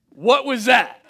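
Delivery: high, loud, startled with a laugh; shocked kid asking what just happened; stunned question. Retold or imitated. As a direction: blurt it out in disbelief. Shocked kid questioning his parents.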